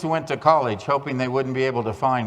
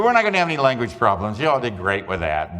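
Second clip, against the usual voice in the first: ordinary talk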